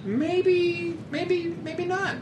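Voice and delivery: way up high, drawn out; high squeaky voice. Acted. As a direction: squeak it out in falsetto. Mock-whiny hedging.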